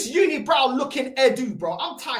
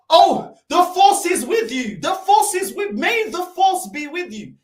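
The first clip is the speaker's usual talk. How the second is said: slow, solemn mock Jedi intonation; mock Obi-Wan; 4 semitones higher, 5 dB louder than usual. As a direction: ham up a wise Jedi blessing to mock him. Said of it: "Obi-Wan Kenobi".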